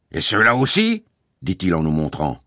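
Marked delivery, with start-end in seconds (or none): 0.1-1.0 high, mocking question; sarcastic. A narrator's reading. Guard points at the children, sarcastic. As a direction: lift it up in mock surprise.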